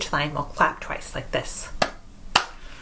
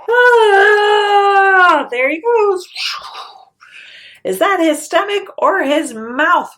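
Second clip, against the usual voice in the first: much higher, loud excited cry; high, loud gleeful goat; excited high exclamation; 13 semitones higher, 9 dB louder, slower than usual. Acted. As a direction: shout it up at the sky in delight. Goat watching troll fly.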